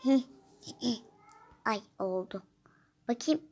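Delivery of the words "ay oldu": a short pretend-sick whisper. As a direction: whisper it, testing the changed voice. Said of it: "Birazcık sesimi değiştireyim şöyle". (hasta taklidi fısıltı)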